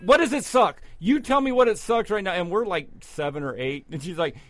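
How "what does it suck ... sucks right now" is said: imitating a scolding aunt, higher and louder; aunt losing it. Aunt scolding the kids for saying sucks, imitated yelling. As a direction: play the furious aunt scolding small kids. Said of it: "she would lose her shit".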